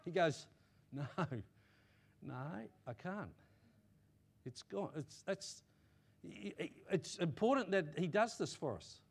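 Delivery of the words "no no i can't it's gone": hushed, unhurried no, with pauses; soft dismissal. Acted. God answering a person who asks if he remembers, soft dismissal. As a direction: answer quietly, letting each refusal sit.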